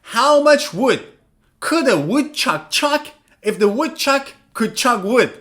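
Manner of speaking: How much wood said slowly and clearly; teacher reading the woodchuck line slowly; slow careful read. Shown as a demonstration. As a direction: read the twister slowly, word by word. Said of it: "look at this one".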